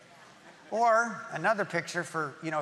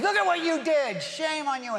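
a higher, louder accusing voice; accusing Satan; 9 semitones higher, 3 dB louder than usual. Retold or imitated. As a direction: jab the blame at them like the accuser. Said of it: "So he just comes in".

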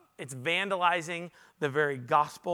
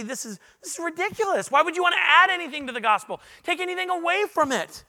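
much higher, incredulous and indignant; exasperated outburst; 11 semitones higher, 5 dB louder than usual. Retold or imitated. Pastor reacting to the scripture with exasperated disbelief. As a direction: let the disbelief spill over. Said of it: "So I read this and I say".

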